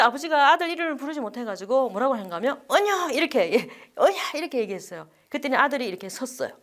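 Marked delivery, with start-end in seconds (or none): 2.7-3.1 calling out. Retold or imitated. 아버지가 떠나는 아들을 부름. 4.0-4.4 calling out. Retold or imitated. 아버지가 떠나는 아들을 부름.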